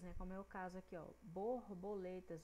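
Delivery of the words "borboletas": Borboletas drawn out, each syllable separate; slow syllable demo. Shown as a demonstration. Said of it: "sílabas também de três letras".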